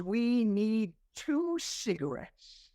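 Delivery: imitating a friend, very slow and deliberate; drawn-out answer. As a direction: stretch it out like a grand revelation.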